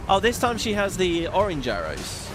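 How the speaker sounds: ordinary talk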